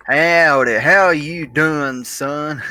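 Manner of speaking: put-on slow Southern drawl from old Westerns; mock Southern accent. Imitating granddad's Westerns for friends, drawn-out Southern accent. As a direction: stretch out a hammy Southern cowboy greeting. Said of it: "I know Southern accents from, like, Westerns".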